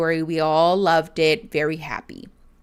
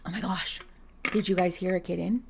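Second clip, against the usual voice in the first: ordinary delivery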